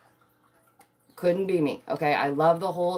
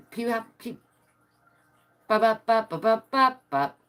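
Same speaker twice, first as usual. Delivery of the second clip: stuttering ba ba ba like a glitching android; mock malfunction stutter; robot glitch; 5 semitones higher than usual. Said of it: "I just glitched. I am an android".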